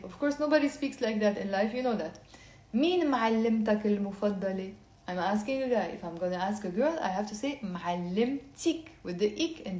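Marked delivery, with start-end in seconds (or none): 2.7-4.7 quick, natural speed; faster. Shown as a demonstration. Teacher repeating the question at natural speed. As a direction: rattle it off at normal pace. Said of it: "I'll say it very slowly and then faster".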